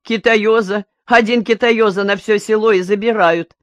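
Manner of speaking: scoffing and incredulous, half laughing. A narrator's reading. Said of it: "засмеялся". (насмешливо, со смехом, громче)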